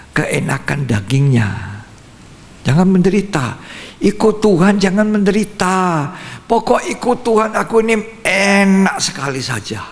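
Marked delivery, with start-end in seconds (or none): 2.7-9.8 high-pitched, mildly whining insistence; mock self-indulgent believer. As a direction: imitate someone insisting on an easy life, pitch raised. Voicing the comfort-seeking human mindset. (menirukan orang yang maunya enak saja)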